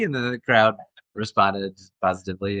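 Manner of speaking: ordinary delivery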